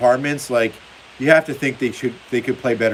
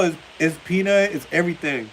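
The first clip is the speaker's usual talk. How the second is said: usual speaking voice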